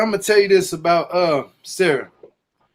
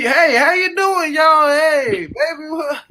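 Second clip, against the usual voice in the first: put-on Southern old man; 9 semitones higher than usual. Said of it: "Everybody talks like that in the South. You can have an old man".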